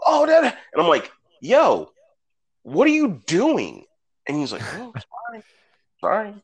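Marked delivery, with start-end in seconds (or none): 0.1-0.4 high, loud "oh, that" at the screen; mock excited kid blurting; excited kid pointing. Nephew pointing at screen mid-movie. 1.4-3.9 exasperated scold. Retold or imitated. Scolding nephew mid-movie. 4.7-6.3 high, soft, sheepish sorry; a nephew caught out, mumbling a high apology; mock meek apology.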